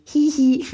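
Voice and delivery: high, loud mock giggle; little giggle. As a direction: squeal out a tiny titter. Acting out a shy wish.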